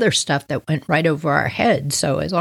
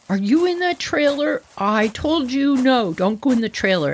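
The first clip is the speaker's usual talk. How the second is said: calling dad; 5 semitones higher than usual. Retold or imitated. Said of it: "we hear my dad go".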